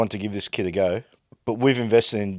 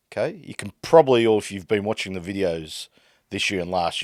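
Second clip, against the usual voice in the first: ordinary delivery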